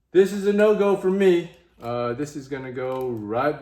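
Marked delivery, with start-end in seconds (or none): 0.1-1.5 loud, high, final declaration; loud verdict. Real, not acted. Vlogger telling viewers he is done, loud and high. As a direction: slam down the verdict. Rejecting the optic.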